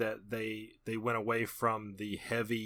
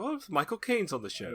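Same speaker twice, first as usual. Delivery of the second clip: Michael Caine voice; 8 semitones higher than usual. Retold or imitated. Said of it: "I was like".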